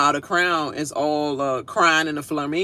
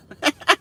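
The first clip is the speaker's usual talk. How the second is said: burst of laughter; 9 semitones higher, 4 dB louder than usual. Real, not acted. Laughing at own line.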